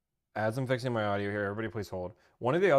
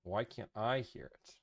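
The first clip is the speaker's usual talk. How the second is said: ordinary talk, nothing marked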